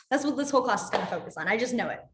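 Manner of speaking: usual speaking voice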